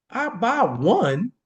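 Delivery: "I buy one" drawn out slowly; a slow, careful restatement. Shown as a demonstration. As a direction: lay the words out one by one. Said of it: "I'ma say that slow".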